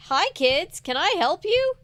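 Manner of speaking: high, loud shopkeeper voice; Mister Paskey voice. Acted. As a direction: chirp it like a cheery shopkeeper. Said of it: "my voice for Mister Paskey".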